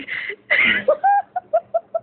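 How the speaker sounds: very high, crying-edged laugh; high squealing laugh. Real, not acted. Laughs at own rant.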